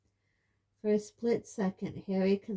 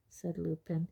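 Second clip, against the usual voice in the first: usual speaking voice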